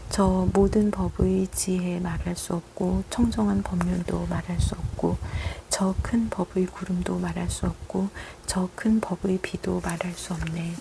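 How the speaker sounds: ordinary talk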